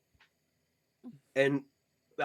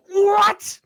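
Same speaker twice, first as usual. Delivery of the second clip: high, loud yelled what from the kitchen; bugbear shout; 8 dB louder, slower than usual. Acted. Bugbear cook shouting from the kitchen, high and loud. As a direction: bellow it from the back room. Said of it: "you just hear".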